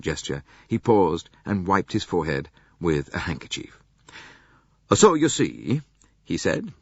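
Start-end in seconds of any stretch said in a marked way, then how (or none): 4.9-5.8 put-on Italian accent. A narrator's reading. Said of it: "with only a slight accent".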